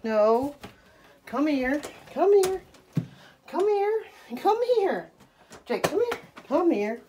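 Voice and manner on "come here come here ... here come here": high coaxing call. Real, not acted. Owner coaxing her dog into the bathroom, high coaxing call. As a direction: lure him in with a high voice.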